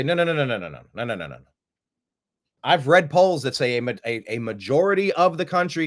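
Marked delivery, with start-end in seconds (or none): 0.0-1.4 emphatic refusal, fading. Real, not acted. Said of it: "I'm just pressing pause to say".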